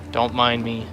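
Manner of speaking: normal talking voice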